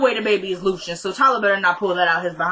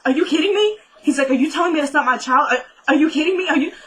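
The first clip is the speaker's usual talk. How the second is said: imitating a stunned father losing it; shocked outrage; 6 semitones higher than usual. Randall hearing paternity results, high shocked disbelief.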